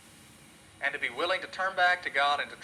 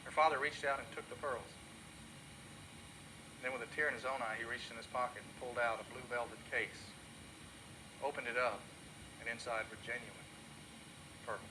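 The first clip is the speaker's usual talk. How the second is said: low, soft, slowing storyteller; soft story ending; 4 semitones lower, 11 dB quieter than usual. A narrator's reading. Preacher closing his sermon story, soft and low. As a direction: let the ending land gently. Story climax read softly.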